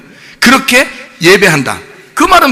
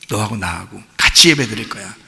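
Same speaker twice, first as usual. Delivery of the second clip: tender repeat; 8 semitones lower, 4 dB quieter than usual. Acted. Jesus's line repeated softly.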